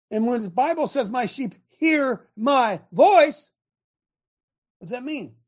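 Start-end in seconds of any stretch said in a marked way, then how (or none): 1.1-3.5 high-pitched, very slow stress on HEAR, MY, VOICE; "hear", "my", "voice" drawn out, raised; emphatic slow quote. Real, not acted. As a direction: drive home each word separately. Verse driven home to listeners.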